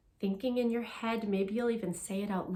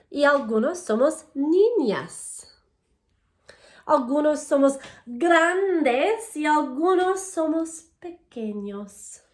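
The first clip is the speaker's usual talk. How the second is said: louder, clear storybook reading to a class; reading to children; 4 semitones higher, 8 dB louder than usual. Acted. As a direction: read each page out to the kids, bright and paced. Reads Spanish picture book aloud.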